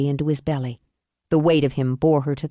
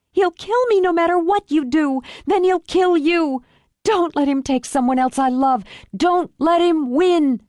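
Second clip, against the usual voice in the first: high, urgent plea from a captive; desperate hostage daughter; 10 semitones higher than usual. Acted. Hostage pleads with rescuer.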